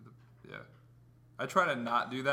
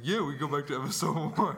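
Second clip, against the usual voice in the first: mock imitation. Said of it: "You're like".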